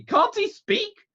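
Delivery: very high, loud, drawn-out demand; impatient cry. A narrator's reading. Aunt exasperated by the silent doctor, crying impatiently. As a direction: exclaim it slowly in sheer exasperation. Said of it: "cried my aunt impatiently".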